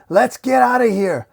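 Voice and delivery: high, urgent shout; acted panicked yell; yell to flee. A narrator's reading. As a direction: call the gang to run, pitched right up. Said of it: "we see the henchman yell".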